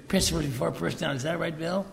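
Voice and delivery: quick, sing-song mock recital. Young guys taunting Bill, fast and mocking. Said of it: "start mocking Bill".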